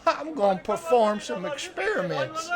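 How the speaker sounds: eager fish-camp hand. Acted. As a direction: share it like a proud, simple fellow, high-pitched. Game master plays Cletus.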